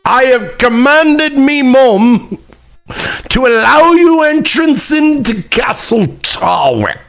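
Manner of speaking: high, slow, hammed-up royal proclamation; grand mock-herald voice, drawn out high; mock lordly decree. Replays message as hammed-up lordly decree.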